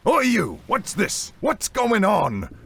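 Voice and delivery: loud, high-pitched challenge; outraged first mate hailing loudly; first mate ringing out. A narrator's reading. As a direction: call out sharply across the deck. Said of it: "the first mate's voice rang out".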